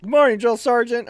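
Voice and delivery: high, loud, friendly hail; bright shouted greeting; cheery call. Retold or imitated. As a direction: call out to him across the room. Calling out cheerfully to sergeant.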